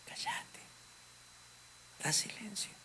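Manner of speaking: soft unvoiced whisper; whispered hush. The Spirit hushing the anxious believer in a whisper. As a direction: breathe the words as a gentle hush. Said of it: "al Espíritu que te dice".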